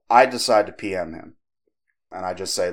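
ordinary talk, nothing marked

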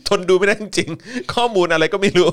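laughing while reading. Real, not acted. Host reads a viewer comment aloud, laughing.

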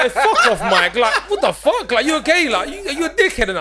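high, loud, laughing mock outrage; mock outraged yell. Friend yelling back at an affectionate message, loud and laughing. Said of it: "Man was like".

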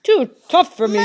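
loud giant's retort; indignant shout. A narrator's reading. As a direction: throw the taunt back at full volume. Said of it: "shouted Cuchulainn".

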